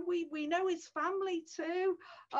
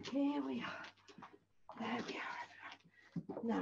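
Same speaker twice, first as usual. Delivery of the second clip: whisper; 4 semitones lower, 11 dB quieter than usual. Shown as a demonstration. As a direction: hush it right down, secret-like. Whispered after normal take.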